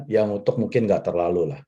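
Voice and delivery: normal talking voice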